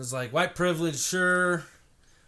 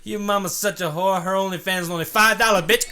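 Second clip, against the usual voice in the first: higher, louder trash talk; mock teen insult; mocking kid; 6 semitones higher, 9 dB louder than usual. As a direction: sneer it like a mouthy teen. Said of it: "Now it's like".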